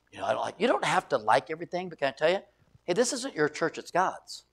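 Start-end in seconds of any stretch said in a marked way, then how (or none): none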